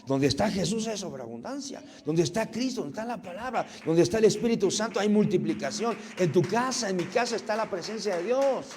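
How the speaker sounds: loud, high, rising preacher's proclamation; fiery preaching. Acted. Pastor proclaiming abundance to the congregation, loud preaching. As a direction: proclaim it like a revival pulpit.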